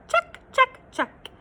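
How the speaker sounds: high checks fading at the end; cheery checks. Acted. Narrator ticking Moose's list, high then fading. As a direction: tick them off, last one dropping away.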